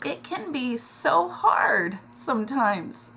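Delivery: fighting back tears, barely getting it out; choking up. Real, not acted. Telling viewers about family strife, real tears. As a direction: voice cracking, slow and breaking on so. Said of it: "This is an emotional one this week".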